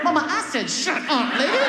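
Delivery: high-pitched cheeky retort; defiant talking back. Retold or imitated. Son to his mother in church, defiant. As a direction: answer back insistently, high.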